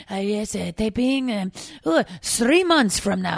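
halting mock French clerk with Spanish-Italian colouring; put-on muddled European accent. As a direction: improvise a strange French-Italian accent, stretching the ending. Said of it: "a strange Spanish slash Italian French".

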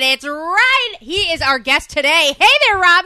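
high, loud excited welcome. Real, not acted. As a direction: greet the guest with a whoop. Welcoming guest enthusiastically.

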